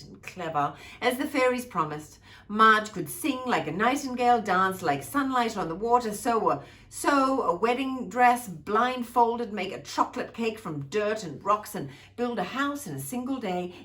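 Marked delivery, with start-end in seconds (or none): none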